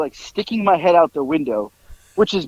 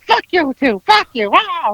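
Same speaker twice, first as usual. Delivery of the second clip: high-pitched mock upset squawk; put-on high, worked-up cursing; all upset; 10 semitones higher, slower than usual. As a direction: imitate someone flustered and lashing back. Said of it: "he's getting all upset".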